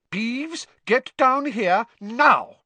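high, loud, slowed stern command; barked order. A narrator's reading. Teacher orders the poltergeist down, barking. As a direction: bark it upwards, drawing out the order. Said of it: "barked Professor McGonagall".

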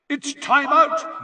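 loud, high announcer's yell; booming high-pitched sports commentator call. A narrator's reading. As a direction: yell it over a stadium crowd, stretched out. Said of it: "yelled Bagman's voice".

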